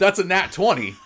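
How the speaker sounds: loud, high, laughing announcement; real excitement. Real, not acted. Player announcing a perfect attack roll, excited. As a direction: crow it with a laugh. Player rolls a natural twenty.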